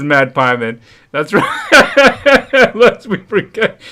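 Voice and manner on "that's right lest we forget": high laughing voice. Real, not acted. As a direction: laugh through it. Laughing at chat.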